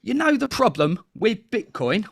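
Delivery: high, animated Cockney market patter; bad Cockney TV-advert voice; terrible Cockney accent. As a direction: pitch it up like a hammed-up Cockney salesman. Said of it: "that was my terrible Cockney accent".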